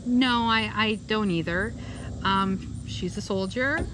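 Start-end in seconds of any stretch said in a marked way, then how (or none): none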